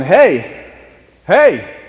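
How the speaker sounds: put-on high, loud Southern girl's "hey"; Southern accent. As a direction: call out like a little Southern girl. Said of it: "she's got a southern accent".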